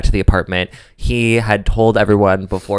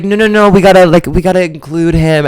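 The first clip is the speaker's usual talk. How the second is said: excited protest; 9 semitones higher, 6 dB louder than usual. Retold or imitated. Retold protest in bed.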